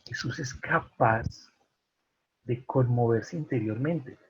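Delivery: ordinary talk, nothing marked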